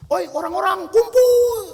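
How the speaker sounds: high, loud call to a crowd. Acted. Student summoning people to gather, calling out. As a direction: holler high to gather everyone. Accuser calls the crowd together.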